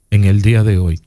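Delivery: ordinary talk, nothing marked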